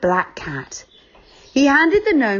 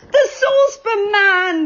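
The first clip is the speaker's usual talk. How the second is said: much higher, gleeful cry; excited shout; 12 semitones higher, 3 dB louder than usual. Acted. The children spotting the saucepan man, shouting together. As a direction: call out spotting a friend. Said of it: "they all cried".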